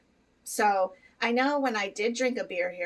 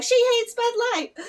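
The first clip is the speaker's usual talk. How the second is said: much higher, louder whiny accusation; mock outraged hater; 8 dB louder than usual. Imagined Bud Light fans accusing her, high and loud. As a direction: ham up an offended beer fan. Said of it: "they're like".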